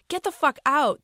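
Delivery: loud, high angry yell. Acted. Driver yelling at his friend to get out, loud and high. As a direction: bark him out of the car.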